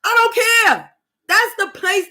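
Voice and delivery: high, loud I don't care; shouted. Real, not acted. Ranting at fake friends, shouting it. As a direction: yell it out, done with them. Peak of rant.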